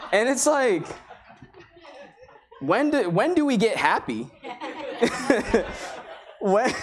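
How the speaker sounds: real laughter breaking through the words; laughing. Real, not acted. Pastor laughing to the congregation over the griping lady's story. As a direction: laugh as you ask it.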